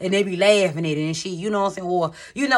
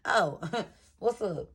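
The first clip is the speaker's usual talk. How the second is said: soft, slow, breezy hello with a little laugh; cool nonchalant greeting; 8 dB quieter, slower than usual. Retold or imitated. Transformed wife greeting her stunned husband, soft and nonchalant. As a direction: greet him like he barely matters. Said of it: "I thought she was like".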